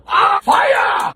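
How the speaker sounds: high-pitched comic shout; hammed-up yelp of alarm; mock alarm. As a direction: cry fire in exaggerated panic.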